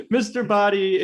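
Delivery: put-on Mister Body in a playful Cockney accent. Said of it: "in a Cockney accent".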